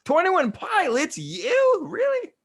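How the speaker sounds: very high disbelieving squeals; shocked teens. Retold or imitated. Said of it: "all these little high schoolers were like".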